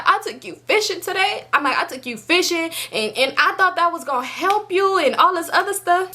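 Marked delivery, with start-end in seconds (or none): 0.0-1.5 high-pitched retold tearful plea; teary-eyed. Teary girlfriend confronting boyfriend about fishing, high-pitched retold upset. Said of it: "I'm getting teary-eyed". 1.8-6.2 high, retold tearful reproach; teary-eyed. Teary girlfriend who hoped fishing would help, high-pitched retold plea. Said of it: "I'm getting teary-eyed".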